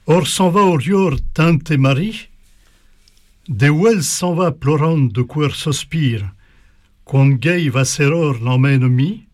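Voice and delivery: reading a twelfth-century chanson de toile aloud; Old French recitation. Shown as a demonstration. Teacher reads an Old French poem to the class, recited verse. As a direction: recite the medieval verse with a lilt, lingering on the refrain. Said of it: "un poème que je vais essayer de vous lire dans la langue de l'époque".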